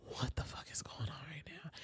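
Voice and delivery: breathy unvoiced inner thought; inner whisper. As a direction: whisper your disbelief to yourself. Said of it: "I was like".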